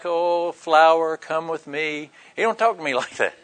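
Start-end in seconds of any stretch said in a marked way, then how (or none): 0.0-2.1 slow, soft, sugary tenderness; mock tender lord. A gentle Lord coaxing a flower, slow and soft. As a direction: coo it gently and slowly. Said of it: "the Lord might talk to you like".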